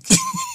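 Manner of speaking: squeaky high laugh cracking up; high giggle. Real, not acted. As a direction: let a high giggle burst out. Laughing at his own skit.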